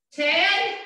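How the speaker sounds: calling out. Real, not acted. Calling out reps mid-workout.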